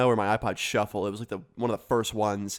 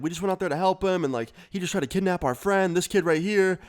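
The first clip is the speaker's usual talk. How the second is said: higher and fast, tumbling out; breathless rush; 6 semitones higher than usual. Retold or imitated. Kids explain the kidnap attempt to the clerk, fast and high. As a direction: blurt it all at once.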